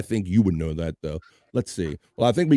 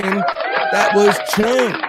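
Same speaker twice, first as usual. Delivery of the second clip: announcer call; 8 semitones higher, 4 dB louder, much slower than usual. Real, not acted. Host announcing caller name.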